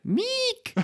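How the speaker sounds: put-on very high-pitched French-accented "Meek!"; French friend's way. Imitating French friend calling her boyfriend, high French-accented cry. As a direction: squeal the name with a French long ee. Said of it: "the way she says it is like".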